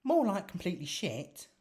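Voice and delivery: high Marcy voice. Acted. Marcy's dry verdict.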